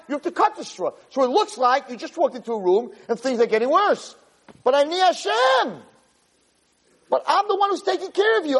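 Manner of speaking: high-pitched impassioned cry; imitated yelling, pitch pushed way up. Hashem yelling at Moshe Rabbeinu, impassioned. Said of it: "That's exactly what he was yelling".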